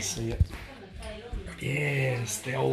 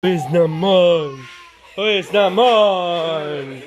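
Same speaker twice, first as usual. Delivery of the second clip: loud, slow, drawn-out call across the house; 6 semitones higher, 11 dB louder, much slower than usual. Real, not acted. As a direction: call for someone far away. Calling out for someone.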